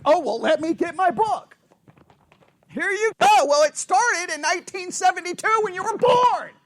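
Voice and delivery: high, loud, hammed-up wife's tirade; wife launching grievances. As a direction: build into a comic litany of complaints. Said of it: "the wife doesn't understand it rhetorical".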